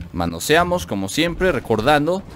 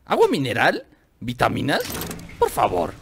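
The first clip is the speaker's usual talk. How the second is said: incredulous. Real, not acted. Player refusing diet items he finds, incredulous. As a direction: question it as if insulted. Repeats item in disbelief, refusing it.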